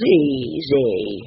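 low, smug boast. A narrator's reading. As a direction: brag it, sure of yourself. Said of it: "he boasted".

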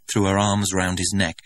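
ordinary delivery, nothing marked

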